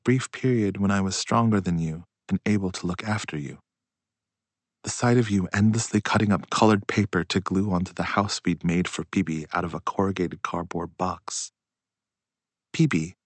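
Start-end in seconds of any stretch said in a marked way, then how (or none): none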